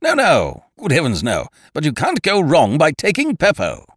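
loud, high emphatic protest; hasty hearty reassurance. A narrator's reading. Ukridge reassuring the offended hostess, loud and hearty. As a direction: protest heartily, then push the product.

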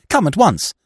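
loud, high urgent summons; urgent call. A narrator's reading. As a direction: call him to come now. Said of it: "I cried".

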